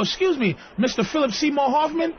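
high, polite questioning voice; timid approach. Retold or imitated. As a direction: imitate a deferential fan approaching a star.

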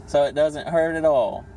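usual speaking voice

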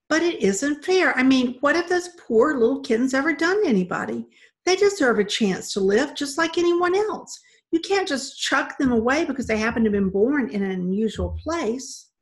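loud, high, indignant plea; impassioned protest. Acted. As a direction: argue hard for their lives. Girl arguing passionately for the kittens.

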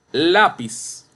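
Lápiz said LÁ-piz, high and loud on LÁ; stress on first syllable. Shown as a demonstration. Teacher giving a new example, Lápiz stressed LÁ-piz. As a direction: punch LÁ in Lápiz. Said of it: "Penúltima sílaba".

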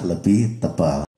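ordinary talk, nothing marked

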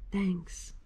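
quiet heartfelt thanks; soft grateful thanks. Acted. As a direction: say it softly, touched. Bear's final grateful thanks.

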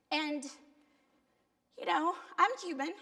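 ordinary delivery

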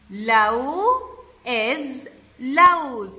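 teacher sounding out "لوز" to the class; syllable split. Shown as a demonstration. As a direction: split "لوز" into two beats, then land the whole word. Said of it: "هم الاثنين حيكونوا مع بعض".

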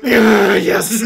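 loud, high, thrilled yeah, yes; excited yes. Retold or imitated. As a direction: blurt it out in delight. Excited at director sitting beside him.

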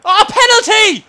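high, loud shout at the referee; real indignant shouting; outraged appeal. Real, not acted. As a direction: yell for the penalty in disbelief.

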